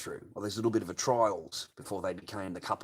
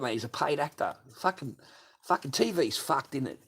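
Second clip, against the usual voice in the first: ordinary talk, nothing marked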